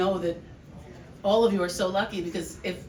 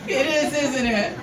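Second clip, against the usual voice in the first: put-on smug child through laughter; 5 semitones higher, 7 dB louder, much slower than usual. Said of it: "And me being like".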